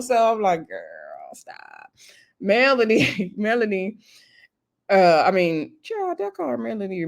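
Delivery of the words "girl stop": barely voiced, dropped right down; hushed aside. Speaker telling Tisha to stop, hushed aside. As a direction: say it under your breath. Acted aside to tipsy friend.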